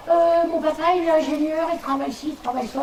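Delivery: put-on high timid little girl. Shy pupil answering the teacher, high little girl voice. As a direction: squeak it out like a shy schoolgirl.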